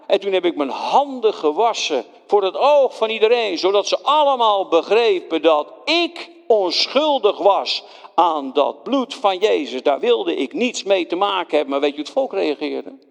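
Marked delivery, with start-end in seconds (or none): none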